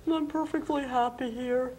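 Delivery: high, squeaky little worm; worm puppet voice. Acted. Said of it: "I'm Willy the Worm".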